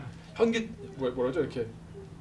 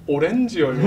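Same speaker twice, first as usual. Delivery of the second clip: proud deadpan answer; 4 dB louder than usual. Retold or imitated. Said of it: "평생 잊지 못할 말".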